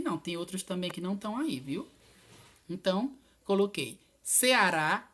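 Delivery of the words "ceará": Ceará drawn out, leaning on the final rá; teacher stretching Ceará toward its stressed ending; stress on last syllable. Shown as a demonstration. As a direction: say Ceará slowly with the rá strongest. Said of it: "olha como rá é o mais forte".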